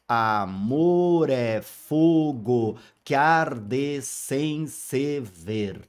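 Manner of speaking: very slow, measured verse; slow syllable reading. Shown as a demonstration. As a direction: read the verse slowly, counting syllables. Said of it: "todos eles têm dez sílabas poéticas". (leitura bem lenta, contando sílabas)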